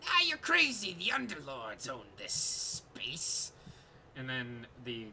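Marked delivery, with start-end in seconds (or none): 0.1-3.5 excited kobold yelling back; kobold shout. Acted. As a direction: squeal it like a frantic little kobold. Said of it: "you hear the kobold shout".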